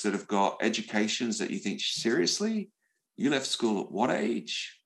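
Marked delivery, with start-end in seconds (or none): 1.8-4.8 mock disbelief. Mock disbelief at someone's education.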